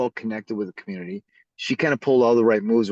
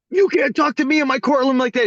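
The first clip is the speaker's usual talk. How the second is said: loud, high-pitched scolding; outraged judge; 12 semitones higher, 6 dB louder than usual. Retold or imitated. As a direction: imitate an affronted judge losing her temper.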